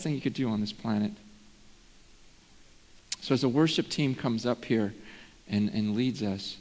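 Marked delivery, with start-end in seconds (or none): none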